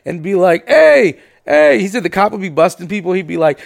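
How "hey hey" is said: taunting call. Retold or imitated. Cousin taunting cop from car.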